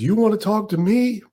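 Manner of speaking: high, amused champ voice; Ali impression. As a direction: ask it high and cocky. Ali addresses old man.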